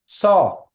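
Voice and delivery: saw said high and loud, falling; Kiowa word saw. Shown as a demonstration. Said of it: "the saw".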